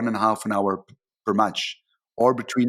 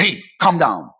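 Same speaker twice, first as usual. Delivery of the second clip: sharp, louder hey calm down; fighter's retort; 3 semitones higher, 7 dB louder than usual. Retold or imitated. Referee snapping back at a shouting player, loud. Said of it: "I'm more a fighter and I want to say".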